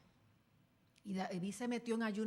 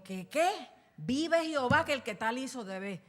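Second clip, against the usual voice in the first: loud, furious cry of an outraged king; indignant outburst; 3 semitones higher, 9 dB louder than usual. Acted. King David, outraged at the rich man, shouting furiously. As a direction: blast it out in royal indignation. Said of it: "Y David se indignó".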